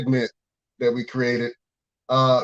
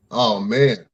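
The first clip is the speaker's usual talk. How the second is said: ordinary talk, nothing marked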